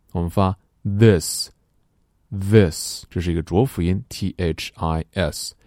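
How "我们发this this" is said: this said very slowly with tongue between the teeth; slow classroom this; drilled slow this. Shown as a demonstration. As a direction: stretch out this, buzzing the voiced th.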